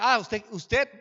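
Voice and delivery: retold threat, hammered out loudly; robber's mocking threat. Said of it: "el hombre me decía".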